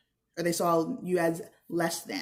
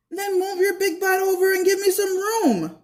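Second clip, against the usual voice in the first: put-on pitched way up and louder, pushy kid voice; cranky kid retort; 12 semitones higher, 6 dB louder than usual. A narrator's reading. Herbie snaps back at Ella in the school bathroom, high bratty kid voice. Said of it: "I said".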